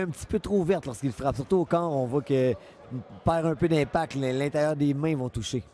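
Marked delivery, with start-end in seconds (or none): none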